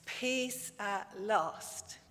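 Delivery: very slow, soft and content; relieved sigh. Acted. Daddy Bear back in his own bed, slow soft relief. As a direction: sink into the pillow with relief.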